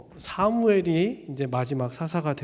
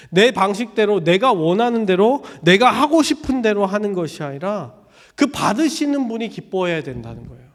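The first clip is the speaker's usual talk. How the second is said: louder, higher insistence; emphatic preaching; 4 semitones higher, 6 dB louder than usual. Real, not acted. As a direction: drive the point home.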